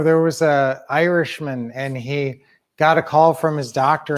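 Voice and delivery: ordinary delivery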